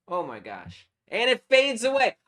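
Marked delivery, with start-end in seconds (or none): 1.1-2.2 higher and louder, thrilled; real delight; excited. Real, not acted. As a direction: celebrate the fade working. Effect works as intended.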